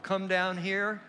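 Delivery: loud high calls to the crowd; yelling across the room. Real, not acted. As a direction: call them down from the stage. Calling people down the aisle.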